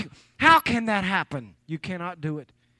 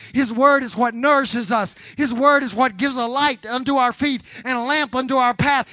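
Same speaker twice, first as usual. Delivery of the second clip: louder, rising, driving preacher's cadence; full-force preaching; 2 semitones higher, 4 dB louder than usual. Real, not acted. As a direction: preach it hard, building to the pulpit peak.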